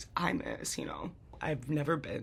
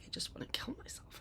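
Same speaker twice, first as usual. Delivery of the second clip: a hushed, much softer confession; whispered despair; 9 dB quieter than usual. Retold or imitated. Brother's desperate words on the phone, whispered. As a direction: let it fall to a near whisper. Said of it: "He's like".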